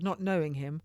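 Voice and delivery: plain reading voice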